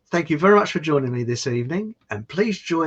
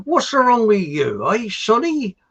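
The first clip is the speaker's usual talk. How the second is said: raised, louder farmhand calling to a lad; farm worker; 9 semitones higher, 5 dB louder, slower than usual. Acted. Farm worker asking the village idiot what's wrong, in character. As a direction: play a joke farm worker, pitched up and drawn out. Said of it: "the other farm workers are saying".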